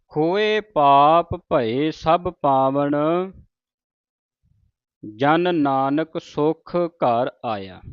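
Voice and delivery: very slow, drawn-out chanting; slow Punjabi recitation. Shown as a demonstration. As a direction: recite the closing line slowly. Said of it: "recites the closing line".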